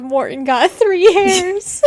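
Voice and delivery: cracking up mid-sentence at a comment; laughing while reading. Real, not acted. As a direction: read it through giggles. Reads a funny comment aloud.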